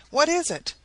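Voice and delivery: high, urgent question; excited exclaiming. A narrator's reading. Crowd demands an answer from Maston, excited and high. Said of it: "exclaimed every one around him".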